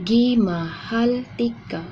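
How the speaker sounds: slow clear teaching take of "Gimahal tika"; Cebuano pronunciation. Shown as a demonstration. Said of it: "And next is".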